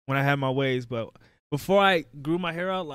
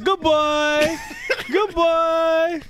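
mock doting relative; 7 semitones higher, much slower than usual. Relatives praising the kid's fresh cut, high mock gushing. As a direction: gush over him like an auntie.

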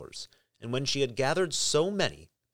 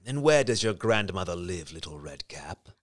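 low, softening wolf; 7 semitones lower than usual. A narrator's reading. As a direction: fade it to a sly hush. The wolf speaks.

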